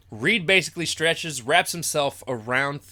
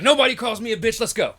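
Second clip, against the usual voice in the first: higher, louder, fired-up yell; defiant shout; 8 semitones higher, 5 dB louder than usual. Retold or imitated. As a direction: snap back, riled up. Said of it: "And he's like".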